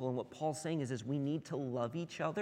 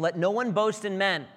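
"Let no one boast in men" rung out louder and higher, hitting boast; emphatic repeat; 6 semitones higher, 9 dB louder than usual. Real, not acted. Pastor rereading the verse to the church, forceful. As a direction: proclaim it as the key line. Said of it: "look again at verse twenty-one".

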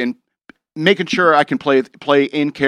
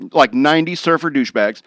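normal talking voice

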